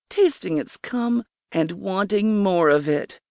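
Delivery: ordinary delivery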